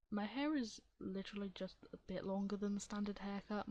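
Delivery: usual speaking voice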